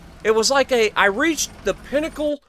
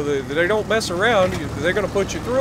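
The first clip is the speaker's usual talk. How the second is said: normal talking voice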